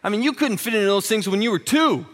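loud, high, blunt husband, slowing at the end; mock blunt outburst. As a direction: blurt the harsh truth. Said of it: "look at you and go".

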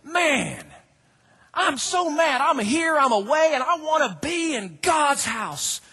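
envy the bird out loud; jealous psalmist. Retold or imitated. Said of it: "just going".

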